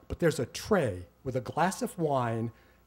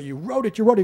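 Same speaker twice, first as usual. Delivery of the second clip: higher-pitched, insistent re-enacted accusation; retold hysterical accusation; 7 semitones higher, 5 dB louder, faster than usual. Arguing with dad about who wrote it, insistent mock hysteria. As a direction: press the charge, mildly worked up. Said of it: "'cause I'm yelling, screaming, getting hysterical".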